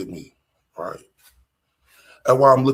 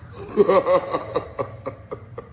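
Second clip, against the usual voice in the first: amused laugh; 5 semitones higher than usual. Real, not acted. Laughing at toddler.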